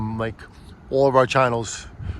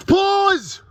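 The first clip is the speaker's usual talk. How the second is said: excited shout; 4 dB louder than usual. Real, not acted. Loud playful call.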